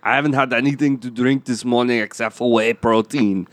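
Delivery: put-on affronted, thick Austrian accent; Arnold Schwarzenegger. Guest denies drinking, Austrian accent. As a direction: protest like an Austrian bodybuilder. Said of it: "Mister Arnold, are you drunk?".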